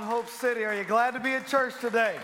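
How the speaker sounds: loud, high call to the crowd; rally the room; rousing call out. Real, not acted. Pastor rousing the congregation.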